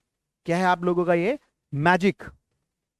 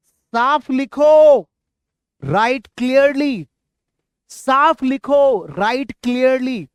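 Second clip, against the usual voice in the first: high, raised voice, slowly stretched words; calling out drill; 4 semitones higher, 5 dB louder, much slower than usual. Shown as a demonstration. Teacher has the class repeat "write clearly", calling out. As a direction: call each phrase out slowly for the class.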